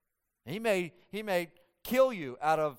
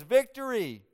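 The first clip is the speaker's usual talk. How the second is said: a triumphant one-word shout; excited victory cry; 7 semitones higher, 6 dB louder than usual. Retold or imitated. Messenger crying victory to the king. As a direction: blurt it out like good news.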